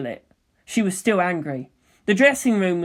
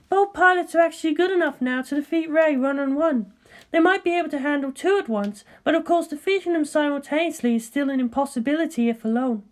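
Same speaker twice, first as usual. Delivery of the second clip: composed scientist, pitched higher; cool, measured colleague; Ritsuko's voice; 10 semitones higher than usual. Acted. Ritsuko congratulating Misato, composed and higher.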